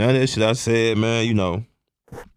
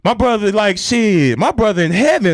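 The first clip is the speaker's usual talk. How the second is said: high and loud with emotion; giddy, emotional retelling of relief; overjoyed; 12 semitones higher, 4 dB louder than usual. Real, not acted. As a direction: let the joy and tears spill over. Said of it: "I'm just so happy".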